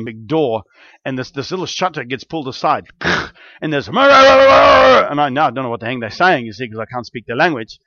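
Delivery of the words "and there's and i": long high held cry, much louder; stretched-out yelp of fright; drawn-out startled cry. Retold or imitated. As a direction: let out a long rising wail of alarm. Bullet scene, long drawn cry.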